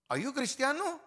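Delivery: high, pointed question in a put-on voice, cristiano; a neighbor asking in Spanish; questioner's voice. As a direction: put someone on the spot. A Spanish-speaking asker quizzes a believer.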